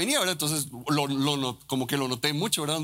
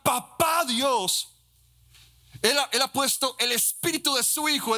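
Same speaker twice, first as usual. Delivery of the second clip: raised, higher, fervent preaching over music; preaching at full force; 9 semitones higher than usual. Acted. Pastor preaching to the church on crying Abba, fervent and high. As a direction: preach it out, lifted and urgent.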